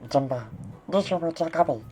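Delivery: Stitch. Acted. Asked for an impression, loud high alien voice. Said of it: "Do a Stitch impression?".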